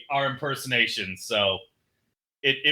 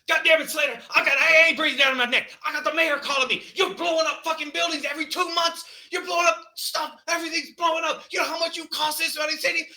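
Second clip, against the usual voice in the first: yelling police chief; 14 semitones higher, faster than usual. Retold or imitated. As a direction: scream it like an exasperated police chief. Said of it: "'cause he stands up every time he's yelling".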